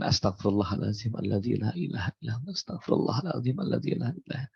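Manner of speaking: soft, hushed murmur of prayer; quiet devout recitation of istighfar; murmured dhikr. Retold or imitated. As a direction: breathe the words out under the breath. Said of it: "Lisan mengatakan".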